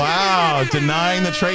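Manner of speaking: loud, high, stunned announcement; surprised. Real, not acted. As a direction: call it out in disbelief. Host reacts to refused trade.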